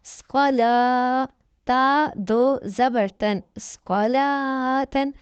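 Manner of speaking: slow sing-song spelling of each letter; slow syllable drill. Shown as a demonstration. Tajweed teacher drilling students syllable by syllable. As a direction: spell out each syllable slowly, like a Quran class.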